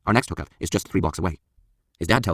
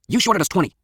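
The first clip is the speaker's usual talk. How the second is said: raised, louder confronting voice; angry accusation; 12 semitones higher, 5 dB louder than usual. A narrator's reading. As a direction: accuse him point-blank. Accuses cheating drug buyer.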